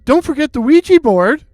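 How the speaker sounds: high-pitched urgent cry. A narrator's reading. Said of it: "Brianna cried out".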